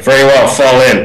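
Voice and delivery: curt officer's command; captain's order. Retold or imitated. Retelling the captain's first order, crisp command. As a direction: bark it like a drill captain. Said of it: "And he went".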